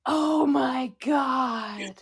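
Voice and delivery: breathy gasped oh my God; slow, stunned, whispered disbelief; shocked whisper. Pretend. Shocked gasp at the joke.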